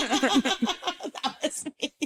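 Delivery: slow, high, through real laughter; laughing. Real, not acted. Storyteller laughing at her own reaction, amused. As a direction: let laughter swallow the words, softly.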